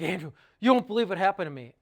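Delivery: loud, quick, agitated quote; imitating upset caller. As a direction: blurt it loudly, imitating someone upset. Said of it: "It sounds upset".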